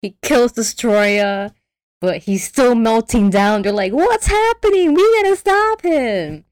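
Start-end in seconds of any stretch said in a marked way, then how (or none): 3.9-6.5 hammed-up alarm, pitched way up; mock panic. Retold panic at meltdown.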